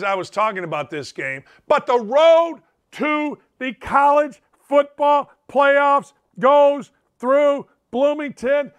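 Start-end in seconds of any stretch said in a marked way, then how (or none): 1.7-8.7 slow, booming, higher hype call; grand proclamation. Acted. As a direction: announce it like a stadium hype man. Big bold proclamation.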